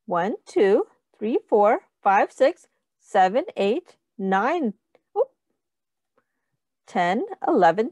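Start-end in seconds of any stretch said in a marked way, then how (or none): none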